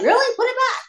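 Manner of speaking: high, light mock astonishment; mock surprise. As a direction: toss it off, feigning shock. Mock casual reply to bone.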